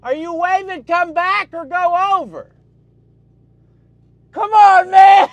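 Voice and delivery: high, loud calling out; exasperated shout. Real, not acted. Calling to a helper waving directions, exasperated shout.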